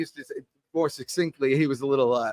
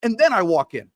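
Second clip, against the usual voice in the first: pumped-up mantra; 3 dB louder than usual. Shown as a demonstration. Salesman reciting his self-hype mantra, building to a loud chant. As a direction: recite the mantra like a fired-up salesman getting in the zone. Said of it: "So it sounds like this".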